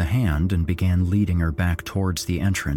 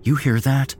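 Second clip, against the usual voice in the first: higher, tense question; alarmed question; 7 semitones higher, 4 dB louder than usual. A narrator's reading. Narrator asks friends about rustling, alarmed. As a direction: ask on edge about a noise. Hearing something in the trees.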